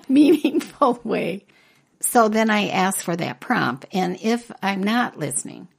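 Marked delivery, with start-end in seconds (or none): 0.0-1.5 laughing. Real, not acted. Laughing at her own phrase.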